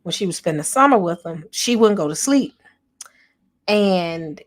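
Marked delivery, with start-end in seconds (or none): none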